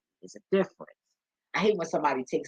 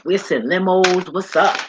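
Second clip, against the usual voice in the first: loud, raised imitation of eager hosts; hyped-up invitation; 5 semitones higher, 9 dB louder than usual. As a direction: call out the invite, hyping it up. Acts out hosts inviting her.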